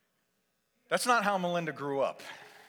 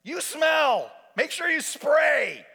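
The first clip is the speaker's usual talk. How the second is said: loud, high, drawn-out shout; acted brazen nurse; blunt scolding; 9 semitones higher, 6 dB louder, much slower than usual. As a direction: holler orders into the bathroom. Wife barging into bathroom.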